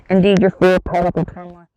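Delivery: loud, booming villain proclamation; villain's boast. Acted. Rolo gloats over his dark flames, loud booming boast. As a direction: boom it out like a triumphant sorcerer.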